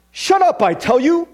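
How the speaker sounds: loud, high, irritated British outburst; impatient snarl. A narrator's reading. Ghost to the lizard on his shoulder, impatient snarl. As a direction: snap it impatiently in a put-on British accent. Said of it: "with a snarl of impatience".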